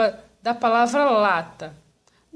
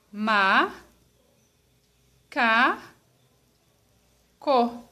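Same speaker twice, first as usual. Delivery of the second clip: "ma, ca, co" as three isolated syllables with long pauses; much slower than usual. Shown as a demonstration. Teacher dividing macaco into pieces, syllable by syllable. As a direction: say "ma, ca, co" one slow piece at a time. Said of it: "Vou falar devagar essa palavra".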